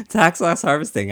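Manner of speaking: can't keep a straight face; laughing. Real, not acted. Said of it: "I just can't say it with a straight face".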